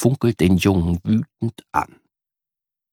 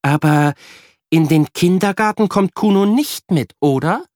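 higher, louder questioning mum; checking with her son; mildly doubtful mother; mother asking; 9 semitones higher, 4 dB louder than usual. A narrator's reading. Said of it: "fragt Mama".

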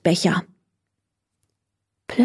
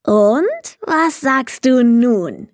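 higher, slow, expectant question; 4 semitones higher, slower than usual. A narrator's reading. Kobold after finishing Leni's teeth, slowly expectant. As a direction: draw it out, fishing for praise. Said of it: "fragte er erwartungsvoll".

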